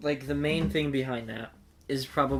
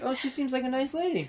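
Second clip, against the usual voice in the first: mock naive cheer; 10 semitones higher than usual. Retelling naive first impression.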